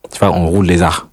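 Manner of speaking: ordinary talk, nothing marked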